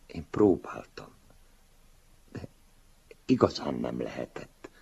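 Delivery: whispered, voice breaking off, sheepish; embarrassed whisper. A narrator's reading. Older self whispering excuses to his stern younger self. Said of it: "zavartan mosolyogva suttogtam".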